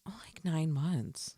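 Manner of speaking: an unvoiced, slow whisper; hushed, embarrassed little answer; whispered aside. Quoting a shy answer.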